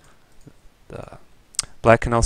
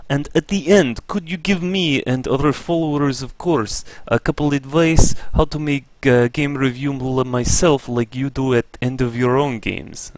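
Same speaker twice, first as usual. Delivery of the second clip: hammed-up Russian accent, pitched higher all through; terrible Russian accent; 4 semitones higher, 4 dB louder than usual. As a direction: put on a clumsy, overdone Russian accent for the viewer. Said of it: "the terrible Russian accent".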